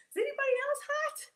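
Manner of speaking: fan yourself and squeak it out; giddy fast question; flustered. Real, not acted. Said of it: "got a little warm in here".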